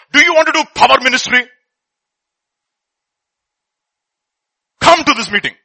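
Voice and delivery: loud, high, fast hype pitch; hyped promoter. Retold or imitated. Church message mocked as a hype pitch. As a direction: sell it like an ad. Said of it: "one guy".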